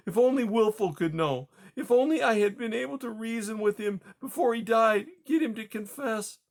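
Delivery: high, aching lament; grieving. Acted. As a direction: mourn it with longing. Widow grieving for lost husband.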